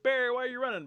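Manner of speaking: very high, very loud question; frantic shouted call. Acted. Animals catching up with Squirrel, hollering urgently. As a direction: holler after someone running off.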